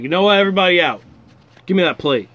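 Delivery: loud, commanding order; bossy shout. Retold or imitated. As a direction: clear the room, bossy and loud. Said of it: "I'm like".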